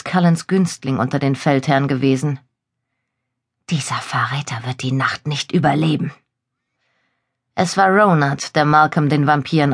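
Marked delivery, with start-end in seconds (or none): 3.7-6.1 hushed, vengeful threat; menacing whisper. Acted. Captive prince swearing revenge.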